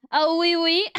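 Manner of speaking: high, hammed-up Blackadder French accent. Streamer imitates the Blackadder French accent for chat. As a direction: ham up the comic French of old British comedy. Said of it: "the Blackadder French accent".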